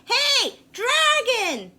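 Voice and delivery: high, loud shout of his name. A narrator's reading. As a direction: yell right in his ear. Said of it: "She shouted as loud as she could".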